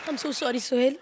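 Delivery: low, soft mock condolence; mock sorrowful. Woman breaks news of her wedding to Sohail, mock sorrowful.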